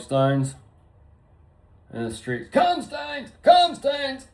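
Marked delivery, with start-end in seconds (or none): 2.5-3.3 high calling yell for a dog; mock calling out. Calling his lost dog across the street, high mock call. 3.4-4.3 higher, louder yell for the dog; mock shout. Calling his lost dog in the streets, high loud mock shout.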